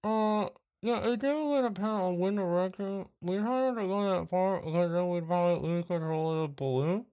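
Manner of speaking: a hint of a weary phone agent; travel agent voice. GM plays travel agency worker.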